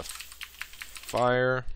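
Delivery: normal talking voice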